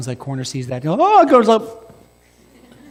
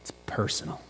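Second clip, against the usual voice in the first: whispered aside; 10 dB quieter than usual. Acted. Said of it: "Now it's a personal challenge".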